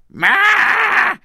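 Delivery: loud yowling cat; loud cat cry. Shown as a demonstration. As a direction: yowl it like a cat. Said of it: "ma means".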